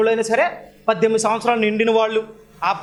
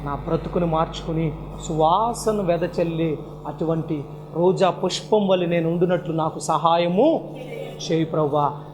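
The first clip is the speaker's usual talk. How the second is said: slow, softer, lower prayer; humble prayer; 5 semitones lower, 4 dB quieter than usual. Shown as a demonstration. Preacher modelling a resolution prayer for the listeners, slow and soft. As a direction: pray it gently, asking for help. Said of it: "ఈరోజు ఒక్కటే తీర్మానం".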